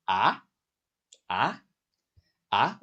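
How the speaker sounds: "ah" rising steeply each time; repeated questioning "ah", pitch sweeping upward; second tone. Shown as a demonstration. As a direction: ask "ah" as if saying what?. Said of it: "the pitch is rising, like you're asking what".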